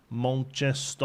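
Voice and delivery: slow, exaggerated Mancunian, A's turned to O's; Manchester accent. Shown as a demonstration. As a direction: stretch out "Manchester" in broad Mancunian, like "Monchestoh". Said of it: "replace all the A's with O's".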